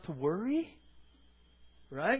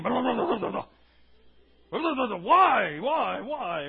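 hammed-up whiny grumbling, louder; mock grumbling; 7 dB louder than usual. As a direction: play a complainer going blah blah. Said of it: "grumblers and complainers".

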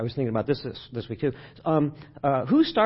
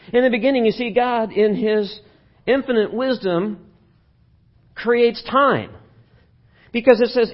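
higher and louder, building sermon emphasis; preaching at full force; 7 semitones higher, 5 dB louder than usual. Acted. Pastor preaching to the congregation on Genesis, full force. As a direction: drive the point home to the congregation.

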